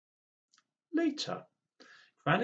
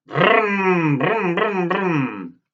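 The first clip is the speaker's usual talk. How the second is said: loud, high revving engine noise; motorbike brum brum; 7 semitones higher, 15 dB louder, much slower than usual. Acted. Granny arriving on her motorbike, loud high engine noises. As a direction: roar like a motorbike.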